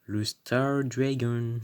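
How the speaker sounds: slow, drawn-out English accent. Shown as a demonstration. Unveiling a diamond card to viewers, drawn-out English accent. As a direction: stretch the name in a put-on English accent. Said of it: "dire les noms en anglais".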